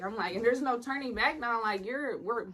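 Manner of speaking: usual speaking voice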